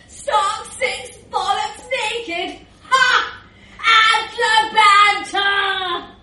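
loud, high, drawn-out lad's cheer; rowdy boasting. Acted. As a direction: bellow it like a stag-party chant. Bragging lad recounts stag party antics.

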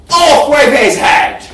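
loud royal yell; mock royal decree. Shown as a demonstration. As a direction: bellow it like a mad queen. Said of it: "and yell".

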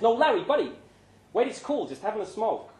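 calm him down quickly; lightly voiced friend holding up his hands; placating Pancho.

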